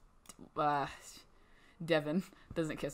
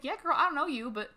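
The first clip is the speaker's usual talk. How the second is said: voicing a stranger; 9 semitones higher than usual. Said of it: "She's like".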